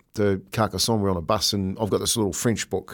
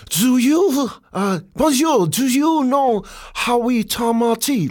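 put-on high, drawn-out French-accented English; 11 semitones higher, slower than usual. French stranger asks the touring players, high slow French accent. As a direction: imitate a Frenchman labouring through English. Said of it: "in his French accent".

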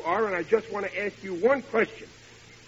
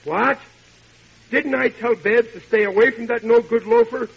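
higher, louder indignant father; angry outburst; 5 semitones higher, 6 dB louder than usual. Acted. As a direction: blow up at the news. Father hears daughter is out.

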